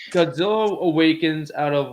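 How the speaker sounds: ordinary talk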